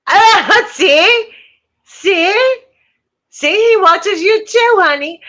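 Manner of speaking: high sing-song voice for the cat; pet talk. Real, not acted. Owner cooing to her cat on camera, high pet talk. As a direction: coo at the kitty watching the stream.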